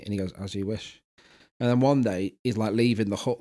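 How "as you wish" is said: soft, low, humble answer; quiet devoted reply. Retold or imitated. Stable boy answering the princess, quiet and humble. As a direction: say it softly, like a secret confession.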